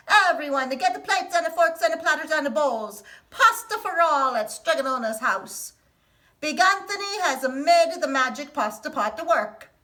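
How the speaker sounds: loud, high crowing call to the square with a laugh; shouted announcement. Acted. Big Anthony from the fountain to the townspeople, gleeful shout. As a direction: call out to the whole town, proud and giddy. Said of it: "and shouted".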